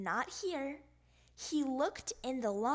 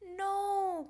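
cried out; 7 semitones higher, 3 dB louder than usual. Acted. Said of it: "cried Froggy".

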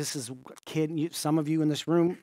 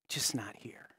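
hushed whisper, voice dropping away; 4 semitones lower, 9 dB quieter than usual. Retold or imitated. Pastor whispering comfort to the dying girl, hushed whisper. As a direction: breathe it softly to a dying child.

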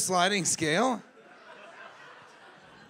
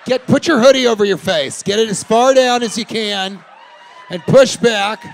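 loud, higher ordering around; mock bossy mom; 4 semitones higher, 11 dB louder than usual. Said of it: "And it's like".

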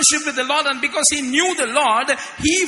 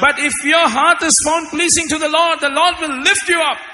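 preaching at full force; 5 dB louder than usual. Acted. Interpreter matches rising sermon.

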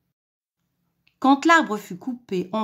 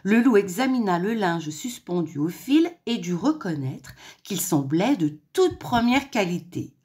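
ordinary delivery

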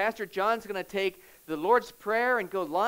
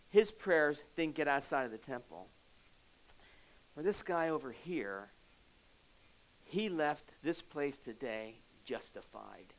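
softer, lower, gentle telling; quiet Jesus; 5 semitones lower, 6 dB quieter than usual. Acted. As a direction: ease down to a hushed, calm explanation. Jesus voiced explaining.

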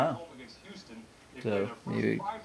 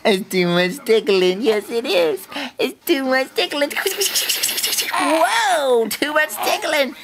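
lightly voiced baby, rising higher; funny baby voice; 7 semitones higher than usual. Dad voicing his baby in a funny voice. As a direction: voice the baby, climbing up. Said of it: "Why are you talking in that funny voice".

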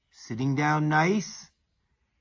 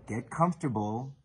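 ordinary delivery, nothing marked

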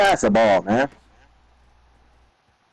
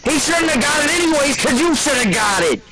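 rising furious yell at a teammate; angry yelling; 11 semitones higher than usual. Real, not acted. Yelling at teammate over video game.

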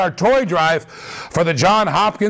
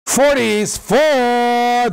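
drawn-out prize call; 6 semitones higher, much slower than usual. Real, not acted. Repeating the prize live.

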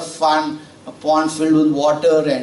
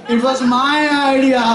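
proud boast; 9 semitones higher than usual. Acted. Frog boasting to farmer about his idea, high proud voice. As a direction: crow about the idea with a laugh.

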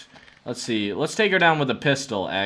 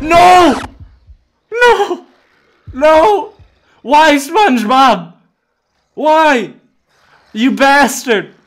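high, loud anguished yells; anguished shouting; 10 semitones higher, 8 dB louder, slower than usual. Real, not acted. Gamer killed by zombie SpongeBob, anguished shouting. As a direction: scream your protest as you die.

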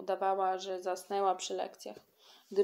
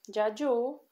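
rising, impatient call, a touch higher; irritated call; 4 semitones higher, 4 dB louder than usual. A narrator's reading. Kasia calling grandpa, jealous and irritated. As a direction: demand his attention. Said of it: "zawołała wyraźnie rozdrażniona".

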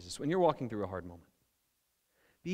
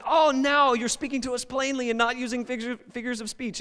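higher, pleased "we finally get it"; eager mock realization; 11 semitones higher, 5 dB louder than usual. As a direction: play the disciples proud they understand. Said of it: "They're, they're going".

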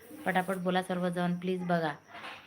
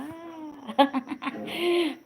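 high laugh; 8 semitones higher than usual. Real, not acted. Laughing at compliment on dancing.

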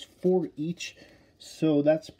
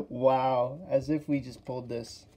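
normal talking voice

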